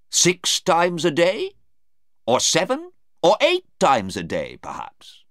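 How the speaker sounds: emphatic lawyer, rising in pitch on the taunts; lightly voiced, needling prosecutor; sarcastic cross-examiner. Lawyer mocking Sarah's toothpaste story, needling.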